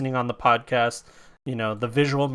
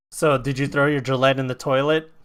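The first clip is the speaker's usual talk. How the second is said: ordinary delivery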